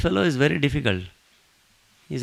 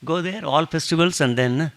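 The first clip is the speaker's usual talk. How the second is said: loud, higher, insistent voice; the saint insisting he will be in the devotee's home; emphatic promise; 4 semitones higher, 10 dB louder than usual. Retold or imitated. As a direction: promise it loudly, pressing each word. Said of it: "he says emphatically".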